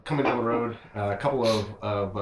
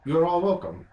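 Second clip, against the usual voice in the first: lightly voiced caravan master, higher and louder; a hint of hearty welcome; Stanimir; 9 semitones higher, 7 dB louder than usual. Caravan master welcoming the party, a touch louder.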